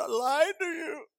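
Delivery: high, tearful mock confession; imitated weeping. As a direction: imitate a wife confessing through tears.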